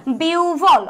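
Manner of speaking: "বিউভল" with a long biu, then a falling bhol; correct pronunciation. Shown as a demonstration. Teacher gives students the right answer, "বিউভল" drawn out as biuu-bhol. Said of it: "একের উত্তর কি".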